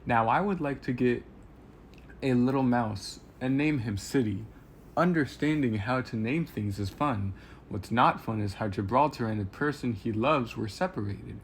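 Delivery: animated storytelling in a guileless robot character's words; upbeat audiobook read with clear emphasis; first-person story narration. Acted. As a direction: read a robot's diary aloud, lively and clear. Reads a game character's story aloud.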